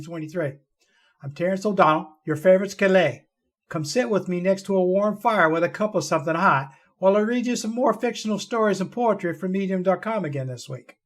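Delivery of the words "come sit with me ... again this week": ordinary delivery, nothing marked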